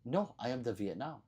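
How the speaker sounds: ordinary talk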